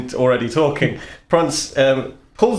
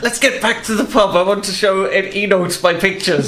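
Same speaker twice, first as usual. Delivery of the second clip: higher, giddy and chuffed; 5 semitones higher than usual. Acted. Prance, giddy, urging a return to the pub. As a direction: bounce it out happily.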